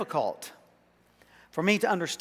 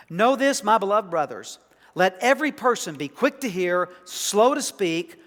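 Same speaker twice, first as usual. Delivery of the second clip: scripture reading; 5 semitones higher, 6 dB louder than usual. Acted. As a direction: proclaim the verse to the congregation. Reading scripture aloud from James.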